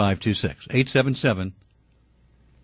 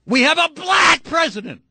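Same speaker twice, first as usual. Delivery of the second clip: angry shout; 9 semitones higher than usual. Real, not acted. Host telling birthers off, angry shouting. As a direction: hammer it out at the top of the voice.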